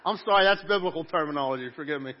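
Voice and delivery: sudden very loud comic correction; mock apology. Preacher excuses his joke to congregation, mock apologetic. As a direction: blurt the excuse out at full volume. Joking apology for saying sacrificing.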